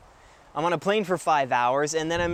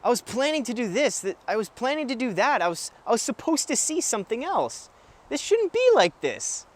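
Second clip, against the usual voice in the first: higher-pitched fretting, protesting inner voice; acted disappointed thoughts; 7 semitones higher than usual. Vlogger replaying the thoughts from the trip, high-pitched protest. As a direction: voice your own complaining thoughts, pitched up. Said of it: "the thoughts I was having was".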